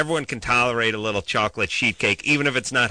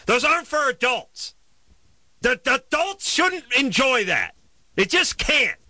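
worked-up rant; 9 semitones higher than usual. Real, not acted.

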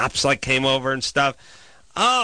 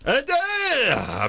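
pitched-up yell down the stairs; mock yell; 12 semitones higher, much slower than usual. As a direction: holler down angrily. Yelling down the stairs.

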